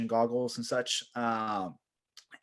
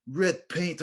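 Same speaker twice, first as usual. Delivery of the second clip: higher-pitched mock Russian pilot; Russian accent; 7 semitones higher, 4 dB louder than usual. Crash-landed pilot dismissing his head wound, Russian accent. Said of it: "there was a Russian pilot".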